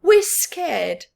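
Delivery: hammed-up fear; mock scared. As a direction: play it scared. Fox scared.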